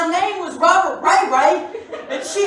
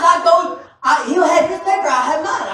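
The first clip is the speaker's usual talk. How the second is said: normal talking voice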